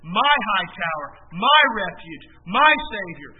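"my" punched each time in a measured reading; stress on "my". Real, not acted. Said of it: "notice the personal pronouns as I read this".

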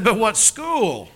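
pointed question. Retold or imitated. Wealthy woman presses him.

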